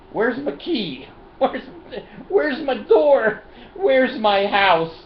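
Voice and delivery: high, slow, dragged-out lost voice; hammed-up bewildered wandering; mock befuddled drunk. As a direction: play a confused drunk hunting for home.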